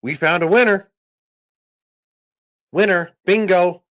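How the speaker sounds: high, loud prize announcer; mock game-show host. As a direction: ham up a quiz host crowning a winner. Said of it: "Russia went".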